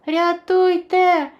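long, high, loud cry for help; shouting for help. A narrator's reading. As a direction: call out for rescue. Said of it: "кричав Расмус".

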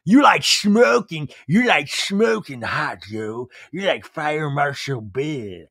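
slow, drawn-out goofy imitation; mock clumsy compliment. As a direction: stretch the flattery out in a put-on voice. Exaggerated replay of clumsy compliment.

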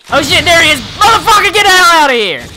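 high, loud panicked yelling; panicked shout. Real, not acted. Player screaming at an attacking monster, panicked and loud.

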